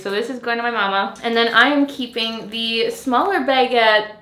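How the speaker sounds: usual speaking voice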